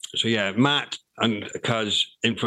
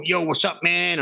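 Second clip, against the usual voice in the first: lightly imitated American rap accent, pitched higher; mock American rapper; 8 semitones higher than usual. Recalling a young rapper copying American style in a light American accent. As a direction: tease his put-on American accent. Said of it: "he was rapping like".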